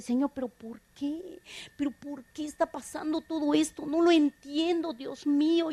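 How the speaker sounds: soft, mildly pleading prayer; understated anguished plea; retold tearful questioning. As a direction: quietly ask God why, reenacting past hurt. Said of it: "Yo me la pasaba llorando".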